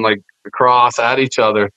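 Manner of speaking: usual speaking voice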